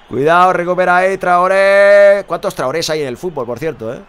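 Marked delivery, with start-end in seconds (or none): 0.1-2.2 narración que se anima. Real, not acted. Jugada en directo tras reírse.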